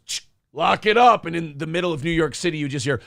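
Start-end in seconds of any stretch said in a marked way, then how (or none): 0.1-1.3 loud command; shouted order. Pretend. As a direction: yell it down into the sewer. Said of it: "and just goes".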